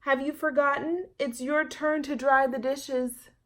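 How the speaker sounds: lightly voiced mother calling out. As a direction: mom reminding her daughter of chores. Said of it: "her mother called from the kitchen".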